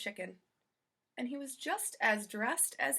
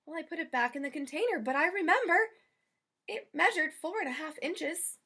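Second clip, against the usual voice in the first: a higher, lightly voiced eager housekeeper; Amelia Bedelia voice; 7 semitones higher, 5 dB louder than usual. Amelia Bedelia wondering aloud about dressing the chicken, lightly voiced higher. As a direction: sound puzzled but keen about the chicken.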